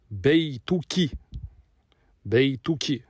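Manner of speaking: Beituki said slowly and clearly, each syllable apart; slow model word. Shown as a demonstration. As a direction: spell out Beituki slowly for the class. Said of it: "au féminin". (Beituki, lentement et bien articulé)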